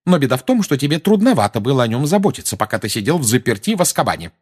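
mother scolding Sirius, voice up; Mrs Weasley. Acted. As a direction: jab at him with reproach.

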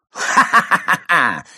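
loud, high sharp cackle; evil laugh. Acted. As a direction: burst into a wicked laugh. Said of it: "резко и зло рассмеялся".

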